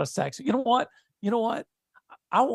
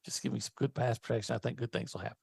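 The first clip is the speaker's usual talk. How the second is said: whispered; 5 semitones lower, 7 dB quieter than usual. Real, not acted. Wishing for the game.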